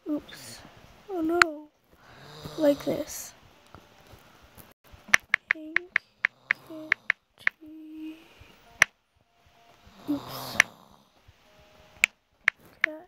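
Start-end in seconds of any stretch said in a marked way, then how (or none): none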